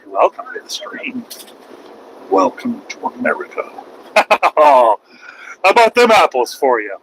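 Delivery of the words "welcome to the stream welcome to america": whispered snide welcome, much softer; sarcastic whisper. Real, not acted. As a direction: hush it out, dripping sarcasm. Sarcastic aside after the outburst.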